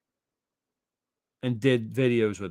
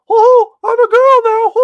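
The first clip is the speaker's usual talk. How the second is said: much higher, louder, slower mock falsetto of a YouTuber; cartoonish high-pitched mockery of a guy claiming he's a girl; mocking squeaky voice; 16 dB louder, slower than usual. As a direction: ham up a squeaky Mickey Mouse girl voice. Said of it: "That's how he talked".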